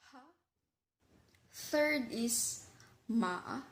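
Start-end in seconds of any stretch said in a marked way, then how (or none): 0.1-0.2 "ha" said with a rising question tune. Shown as a demonstration. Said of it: "when you ask a question". 3.1-3.6 "ma" dipping down then up; third tone. Shown as a demonstration. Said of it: "falling rising tone".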